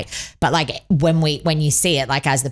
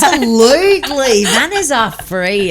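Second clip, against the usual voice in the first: loud, high, drawn-out insistence; emphatic agreement; 9 semitones higher, 5 dB louder, slower than usual. Real, not acted. Mum insisting on manners, loud emphatic agreement. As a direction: stretch it out with conviction.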